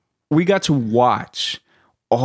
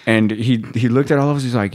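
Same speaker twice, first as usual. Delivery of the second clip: ordinary talk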